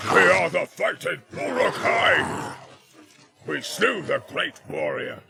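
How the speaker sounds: loud, slow, shouted orc boast; Uglúk roaring his boast; orc war boast. Acted. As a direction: roar it like a proud war chief.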